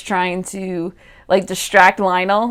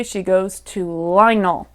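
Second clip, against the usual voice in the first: ordinary delivery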